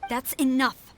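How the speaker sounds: loud sharp cut-off; loud, firm that's enough; stern rebuke. Acted. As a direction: shut the excuses down hard. Cuts off subordinate's excuses.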